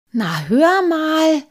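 high, loud, drawn-out protest; aghast. A narrator's reading. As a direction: protest in squeaky alarm. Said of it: "sagte Halbschuh erbleichend".

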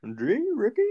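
high, squeaky put-on Bubbles voice; Bubbles impression. Imitating Bubbles for a friend on a podcast, high and squeaky. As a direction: pitch way up like a cartoonish trailer-park buddy. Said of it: "I can't do a good Bubbles impersonation".

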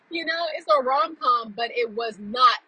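usual speaking voice

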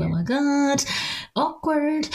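high sing-out of awkwardness; mock panic. Acting out her panic, awkwardness.

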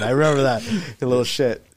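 ordinary talk, nothing marked

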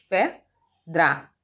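"Pedra" broken as PE, pause, DRA; teacher dividing "Pedra" with a clear gap; split into syllables. Shown as a demonstration. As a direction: count out the two syllables of "Pedra" separately. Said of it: "porque tem duas sílabas".

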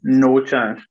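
a flat, dismissive "No chance"; scoffing disbelief. Retold or imitated. Imagined 1996 viewer, dismissive scoff. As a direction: brush it off as impossible. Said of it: "You'd have been like".

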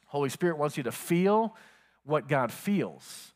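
usual speaking voice

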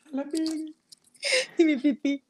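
crying with laughter; laughing. Real, not acted. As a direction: squeal it through laughter. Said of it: "Ya entendí".

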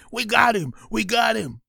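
cheering at the catch; excited shout. Acted. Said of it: "Vinny shouted with joy".

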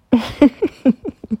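quick embarrassed giggle; sheepish laugh. Real, not acted. Host apologizing for yawning, short sheepish laugh. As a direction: laugh at yourself.

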